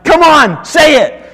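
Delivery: put-on high, loud urging of a stubborn child; exasperated parent pressing the kids. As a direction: push the kid to finally say it.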